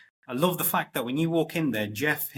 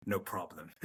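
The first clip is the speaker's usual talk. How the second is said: calme; 7 semitones lower, 10 dB quieter than usual. Retold or imitated. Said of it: "You're like".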